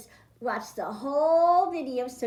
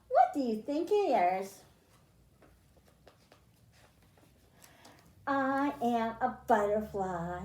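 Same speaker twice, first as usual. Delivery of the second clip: put-on reading to children. Teacher reading a guess-who book to her class, storybook voice. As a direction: read it like a picture book to little kids. Reads picture book aloud.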